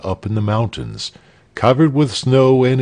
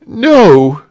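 loud, high-pitched no; emphatic refusal; 10 semitones higher, 8 dB louder than usual. A narrator's reading. Returns defeated with burnt finger.

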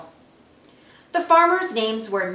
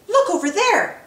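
much higher and louder, pointing out a wonder; excited yell; 10 semitones higher, 3 dB louder than usual. Acted. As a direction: call out in amazement. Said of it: "Goofy yelled".

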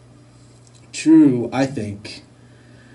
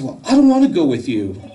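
high, loud, quick imitated refusal; child's attitude; 6 semitones higher, 5 dB louder, faster than usual. Daughter refusing to go with Dad, with attitude. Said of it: "what's up with the attitude".

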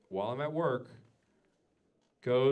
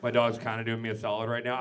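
ordinary delivery

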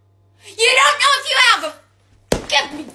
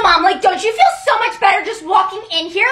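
ordinary talk, nothing marked

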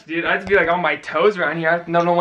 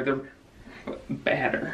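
drawn-out whispered joke; slow whisper; 4 semitones lower, 10 dB quieter, much slower than usual. Pretend. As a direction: whisper it very slowly.

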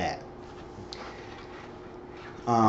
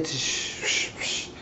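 shushing; 5 dB quieter, much slower than usual. Retold or imitated. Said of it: "I would say".

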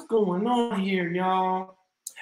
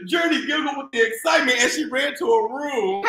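reading through giggles, fast and loud; laughing while reading; 5 semitones higher, 8 dB louder, faster than usual. Real, not acted. Reader giggles along with Journey. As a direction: laugh your way through the line.

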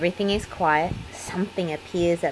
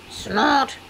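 higher little piggy snort-giggle; giggling snort; 7 semitones higher than usual. A narrator's reading. Little George giggles in bed with a snort. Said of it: "George giggles".

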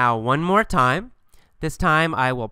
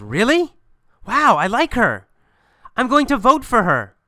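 higher-pitched, wowed reaction; impressed surprise; 7 semitones higher than usual. Acted. Ex-girlfriend reacts to news of his new girlfriend, impressed surprise. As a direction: act pleasantly surprised, pitch swinging up high.

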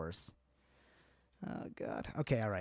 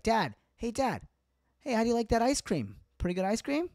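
higher, upbeat coaxing; chirpy son; 10 semitones higher, 3 dB louder than usual. Retold or imitated. Son chatting dad up over ice cream, higher and chirpy. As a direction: brightly try to start small talk. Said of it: "I'll be like".

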